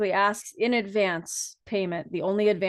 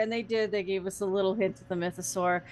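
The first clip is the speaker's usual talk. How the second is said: normal talking voice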